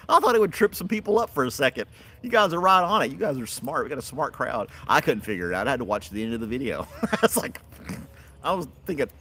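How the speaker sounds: ordinary delivery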